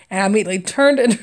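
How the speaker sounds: ordinary talk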